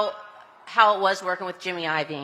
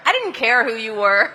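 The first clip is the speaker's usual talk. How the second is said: laughing; 6 semitones higher, 3 dB louder than usual. Real, not acted. Correcting herself, laughing.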